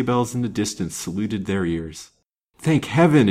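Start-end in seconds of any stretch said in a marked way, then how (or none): 2.6-3.3 louder, higher burst of thanks; relieved exclamation. A narrator's reading. Stranded man hears sleigh bells coming, exclaiming. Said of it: "ejaculated Lance".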